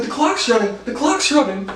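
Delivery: high-pitched mock panic; hammed-up high voice of alarm; mock frantic yelling. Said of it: "The parents are still yelling".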